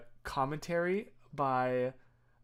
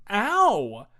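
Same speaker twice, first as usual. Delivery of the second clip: mock yelp of pain; 5 semitones higher, 9 dB louder than usual. Retelling the dog's bite.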